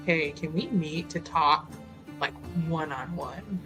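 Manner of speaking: ordinary delivery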